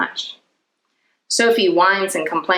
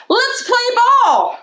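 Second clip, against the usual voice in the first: high shouted call to the field; yelling; 4 dB louder than usual. A narrator's reading. J T calling his team to play, loud yell. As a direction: yell it out to the other kids. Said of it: "he yells to the others".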